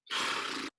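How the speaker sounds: long, soft exhale; acted sigh. Scone hears what dessert he loses, acted sigh. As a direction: sigh as if caught out. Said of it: "And Scone goes".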